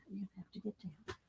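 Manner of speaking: fast, hushed repeat; soft whisper. Real, not acted. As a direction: murmur it quickly to the pet. Repeats softly to pet.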